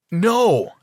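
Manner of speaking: loud, high cry of protest; shouted. A narrator's reading. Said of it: "my dad and I shouted".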